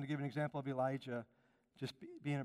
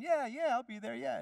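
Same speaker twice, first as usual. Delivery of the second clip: mock eager promise; 9 semitones higher, 6 dB louder than usual. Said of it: "Instead of saying".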